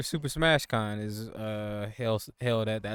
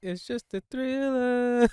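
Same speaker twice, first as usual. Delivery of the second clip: high, drawn-out mocking voice; slow high silly quote; 6 dB louder, slower than usual. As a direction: squeak it out slowly in a silly high voice. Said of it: "'cause I'm like".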